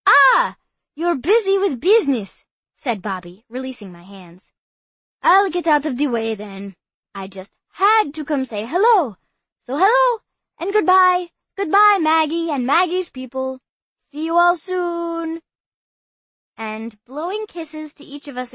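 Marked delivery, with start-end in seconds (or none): 0.1-2.4 high, loud, chirpy; bright understanding boy; eager boy voice. Acted. Bobby's voice. 5.2-15.4 high, loud, sing-song farewell; cheerful goodbye. Acted. Bobby says goodbye.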